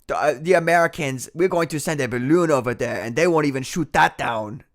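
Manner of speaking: badly faked Chinese accent; horrible Chinese accent. Streamer mimics boastful Chinese officials, bad Chinese accent. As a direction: put on a hammed-up Chinese accent. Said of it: "That's a horrible Chinese accent".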